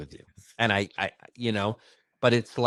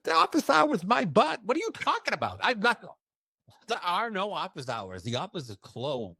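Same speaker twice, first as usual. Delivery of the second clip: high incredulous mock protest, fading down at the end; scoffing disbelief; 9 semitones higher than usual. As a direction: scoff at the idea, pitched way up. Mocking retort to the medium's advice.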